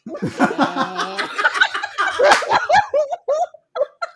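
squealing helpless laughter; high loud laughing fit. Real, not acted. Laughing at whale sound.

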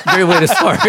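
high, loud sarcastic cheer breaking into laughter; laughing sarcasm. Retold or imitated. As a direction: crack up on a mock celebration. Sarcastic quote told while cracking up.

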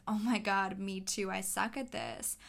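ordinary talk, nothing marked

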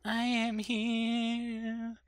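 slow, high, eerie drawn-out voice; ghostly voice. Pretend. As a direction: moan it like a ghost.

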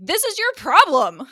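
acted angry outburst, punched loud; mad. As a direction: lash out as the furious dean, hammed up. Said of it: "he's, like, really mad".

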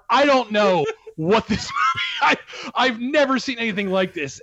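high-pitched, exasperated disbelief with a laugh breaking in; baffled outburst. Real, not acted. Critic at a loss to describe a bizarre film, baffled outburst.

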